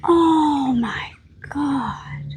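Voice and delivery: slow, tearful cry of awe; overcome with emotion. Real, not acted. Moved to tears by parrots, crying out. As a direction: let the sight break you up. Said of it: "I'm gonna cry".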